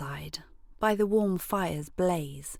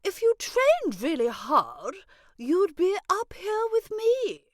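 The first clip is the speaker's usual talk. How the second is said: rally her, rising to a cheer; building excitement; 7 semitones higher than usual. Acted. Butterfly friend cheers the girl on, building to a shout.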